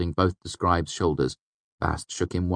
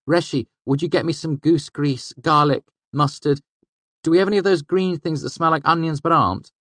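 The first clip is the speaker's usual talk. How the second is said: put-on higher, lively young trickster voice; young fey man voice; 11 semitones higher, 6 dB louder than usual. A narrator's reading. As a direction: voice Bast higher, quick and sly. Bast voiced by narrator.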